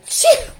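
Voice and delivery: loud, high mock sneeze Chew!; big imitated baby sneeze. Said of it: "they pull up and go".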